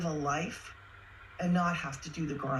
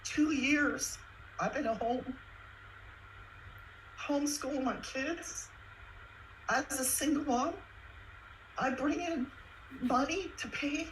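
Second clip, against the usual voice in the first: fighting tears; 7 semitones higher than usual. Real, not acted. Single mom tells viewers her story, near tears. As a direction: push through the words without breaking down. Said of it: "I'm not gonna cry".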